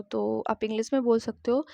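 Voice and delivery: ordinary talk, nothing marked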